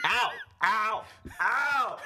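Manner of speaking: high-pitched "ow" yelps; short acted cries of pain; mock pain cries. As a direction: yelp as if being pelted. Acting out being pelted while reading.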